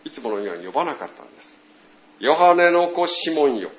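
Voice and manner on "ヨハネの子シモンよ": louder, solemn full-name call; formal address. Acted. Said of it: "改まって". (改まってフルネームで呼ぶ)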